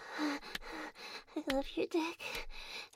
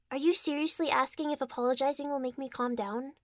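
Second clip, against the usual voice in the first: ordinary talk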